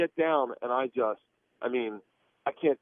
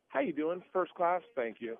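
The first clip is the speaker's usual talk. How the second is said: normal talking voice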